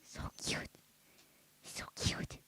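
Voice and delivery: unvoiced whisper, very soft; whispered cooing. Real, not acted. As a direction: breathe it to the dog. Whispering at the dog.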